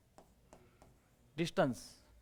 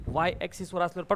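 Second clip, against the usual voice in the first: ordinary talk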